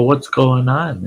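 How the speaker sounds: retold alarmed question; concerned demand. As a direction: step in and ask what's up. Kid confronts scene of crying.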